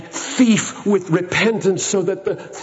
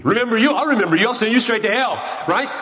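higher, scoffing sneer at the thief; mock scornful reply; 6 semitones higher, much faster than usual. Preacher mimics his own scorn for the thief, mock sneer. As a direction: act out the harsh judge you'd be. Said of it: "preacher voicing what he would do".

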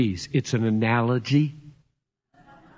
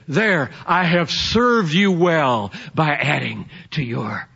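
slower and a little higher, a lightly voiced self-satisfied servant; smug dutiful offering; 4 semitones higher than usual. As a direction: present it like a proud, pompous gift. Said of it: "and say".

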